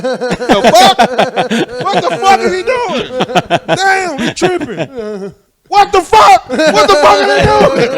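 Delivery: high, loud disbelieving yell; retold outraged shout. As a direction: holler it in comic outrage, building. Retells outrage at trade and repo.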